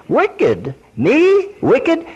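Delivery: mock offended churchgoer; indignant disbelief. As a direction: play the shocked sinner who can't believe it. Voicing an offended listener.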